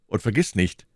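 plain reading voice

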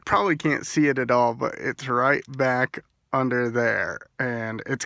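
lips pulled back by fingers; talking while showing teeth; speaking with finger on lip. Real, not acted. Seen 3: Finger pulls lip back to show braces while talking, on and off.

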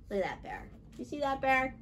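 usual speaking voice

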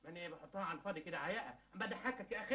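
ordinary delivery

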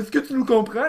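high mock French girl question; hammed-up feminine French voice; exaggerated feminine French girl. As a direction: imitate a movie-style Frenchwoman asking. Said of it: "you, you're like".

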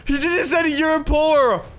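high, incredulous outburst; shocked. Real, not acted. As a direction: exclaim in shocked disbelief. Girl is scanned as poor.

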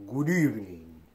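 hammed-up Dracula greeting; bad Dracula. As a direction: do a lightly voiced, corny Dracula. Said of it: "horrible Dracula impression".

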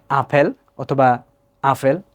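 আপেল first as "apel", then as "afel"; wrong local pronunciations. Shown as a demonstration. Teacher shows two errors, আপেল as "apel" and "afel".

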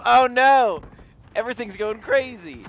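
loud, high panicked shout; excited shout. Real, not acted. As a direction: yell in chaos. Gaming chaos.

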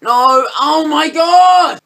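very loud, high, slow "Oh my god"; real shout of dismay. Real, not acted. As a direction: bellow it out at the screen. Tense moment in the game.

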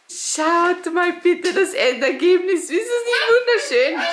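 high, loud and laughing; excited. Real, not acted. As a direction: gush over the decoration. Showing finished party decoration.

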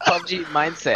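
words through laughter; laughing. Real, not acted. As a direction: laugh the phrase out. Laughing while speaking.

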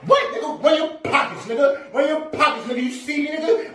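high, hammed-up tough-guy bark; mock thug. Pretending to rob someone, hammed-up menace. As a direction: playact a stickup, over the top.

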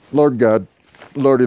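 ordinary delivery

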